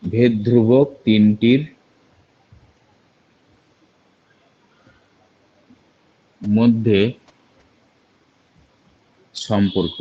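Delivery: slow, word by word with long pauses; slow dictation. Shown as a demonstration. Teacher dictating the relation for students to copy, slowly. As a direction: dictate so each word can be written down. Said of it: "লিখতে পারি কি বলো?".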